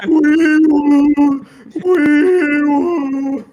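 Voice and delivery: very high, loud siren noise; siren wee woo. Retold or imitated. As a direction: wail like a cartoon siren. SpongeBob siren imitation.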